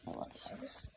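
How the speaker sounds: soft breathy whisper. Real, not acted. Owner whispering love to his cat Iris, unvoiced. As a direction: whisper it lovingly.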